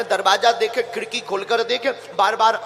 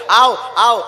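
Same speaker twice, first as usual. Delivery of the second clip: louder, eager summons to relatives; calling out; 7 dB louder, slower than usual. Acted. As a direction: call the guests over. Mother-in-law summons relatives to see sweets. (बुलाते हुए पुकारना)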